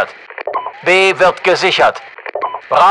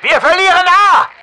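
high, loud urgent yell; high-pitched military alarm callout; alarmed battle shout; 8 semitones higher, 5 dB louder than usual. Acted. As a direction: shout bad news across the battlefield. Shouted alarms of losing positions.

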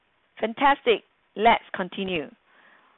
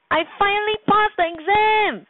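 high, bursting with joy; excited exclamation; 11 semitones higher than usual. Shown as a demonstration. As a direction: shout the good news up high. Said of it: "Rajan exclaimed".

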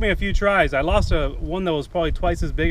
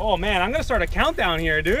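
ordinary talk

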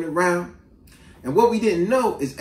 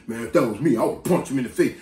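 retold boasting; 7 semitones lower than usual. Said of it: "People always saying".

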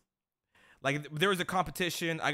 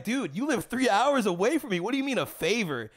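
incredulous retold; 6 semitones higher, 5 dB louder than usual. Retold disbelief at dad's request.

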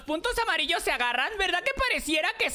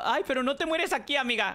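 usual speaking voice

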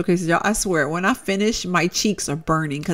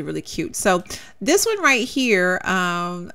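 normal talking voice

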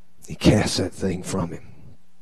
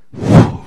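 loud breathy whoosh without voice; 10 dB louder than usual. Acted. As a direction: blow a quick whoosh for a sudden turn. Imitates Jesus turning.

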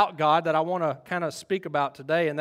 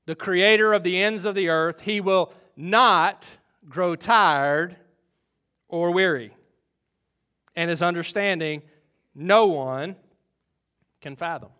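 forceful scripture declamation; 5 dB louder than usual. Acted. Preacher declaring God's tireless strength to congregation, forceful declamation. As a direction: proclaim the verse in big, deliberate phrases.

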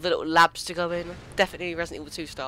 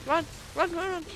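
panicked high yell; 9 semitones higher, 4 dB quieter than usual. Real, not acted. Fleeing monster in panic.

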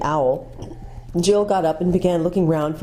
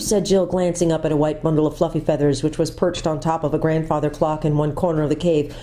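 ordinary delivery, nothing marked

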